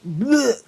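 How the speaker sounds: a quick, high, imitated heave; mock retching bleh. Telling viewers about being sick in the car, a mock retch. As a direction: mimic throwing up for a laugh.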